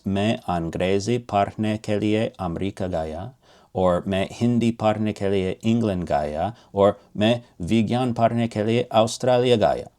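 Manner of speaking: rapid fluent Hindi for मैं अंग्रेजी पढ़ने के लिए अमेरिका गया; Hindi sentences. Shown as a demonstration. Teacher quickly reading the Hindi translations to learners. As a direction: rattle off the Hindi versions quickly. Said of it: "in Hindi, that's".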